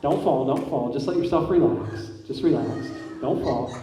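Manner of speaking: calm, steady hypnotist voice; hypnotic suggestion. Acted. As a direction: soothe them so they stay balanced.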